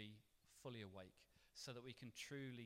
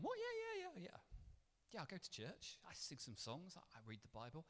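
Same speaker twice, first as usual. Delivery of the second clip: casual churchgoer reply; 3 semitones higher than usual. Retold or imitated. Imagined lukewarm churchgoer answer.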